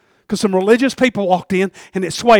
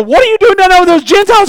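accusing shout; loud and high, demanding answers; 14 dB louder than usual. Retold or imitated. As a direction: call him out loudly from the crowd. Jerusalem leaders confront Peter.

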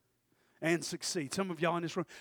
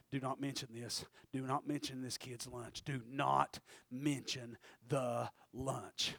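low, soft inner voice, slowing to word by word; hushed self-warning; 3 semitones lower, 6 dB quieter, slower than usual. Acted. [name] telling himself to hide the lunch, hushed. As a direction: caution yourself under your breath.

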